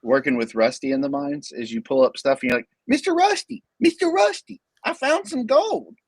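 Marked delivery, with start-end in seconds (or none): 2.9-5.9 pitched-up prospector calling to the boss; old prospector impression. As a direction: squeak it out like a gold rush miner. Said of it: "my impression of, like, a A T eighty San Francisco miner".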